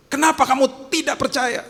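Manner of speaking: high, loud rebuke; Jesus rebuking. Retold or imitated. Jesus to disciples, loud high rebuke. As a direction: scold the frightened disciples. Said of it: "dia ngomong apa".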